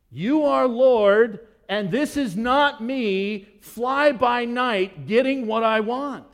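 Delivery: loud, slow, impassioned worship; fervent plea. Acted. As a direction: pour it out, slow and urgent. Paraphrasing the woman's worship.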